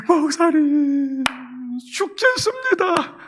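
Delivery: high, drawn-out wailing plea; tearful plea. Acted. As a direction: wail slowly to the pastor, acting desperate. Acting out woman's plea to pastor. (울먹이며 길게 하소연)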